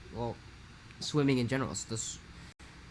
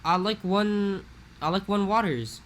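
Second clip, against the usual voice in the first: higher, louder Hawaiian Pidgin "I like one waters"; Pidgin slip to teacher; 8 semitones higher, 7 dB louder than usual. Retold or imitated. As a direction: blurt it out like a local kid to the teacher. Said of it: "you accidentally go".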